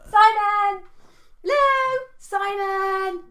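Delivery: high loud sing-song call. Real, not acted. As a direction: shout his name across the car park. Shouting to passer-by from van.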